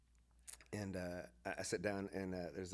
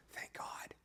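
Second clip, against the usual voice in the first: soft breathy whisper of relief; 8 dB quieter than usual. Retold or imitated. Speaker whispering relief back to the fellow pastor. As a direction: breathe it out in secret.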